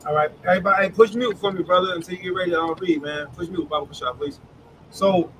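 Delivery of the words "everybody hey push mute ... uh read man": normal talking voice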